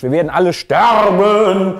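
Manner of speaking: loud, drawn-out hammed-up wail of doom; mock doom cry. Reviewer summing up the end of the world, mock wail. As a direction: stretch the last word in mock panic.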